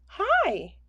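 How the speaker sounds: high, loud bubbling greeting; tiny high bubbling bug; bubbly bug voice. A narrator's reading. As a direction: squeak it out like a spittlebug in froth. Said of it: "bubbled a spittlebug".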